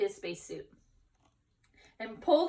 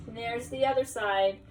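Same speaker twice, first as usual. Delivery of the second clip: plain reading voice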